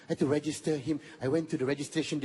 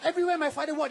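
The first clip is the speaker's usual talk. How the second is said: ordinary delivery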